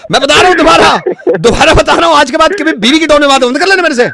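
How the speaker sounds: very high, insistent ranting; hammed-up outrage. Technician forbids wife's tone to customer, outraged rant. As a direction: lay down the law in mock fury.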